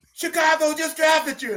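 imitating wife's high-pitched excited news; excited announcement. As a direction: break the news in a high mock shout. Wife breaking exciting news, retold.

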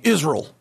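Israel as two-beat IZ-RULL; American mispronunciation. Retold or imitated. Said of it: "when I hear Americans saying".

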